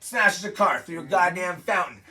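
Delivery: ordinary delivery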